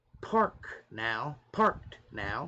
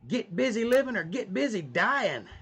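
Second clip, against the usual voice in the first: high emphatic quote; 7 semitones higher than usual. Acted. Reads out a line to himself.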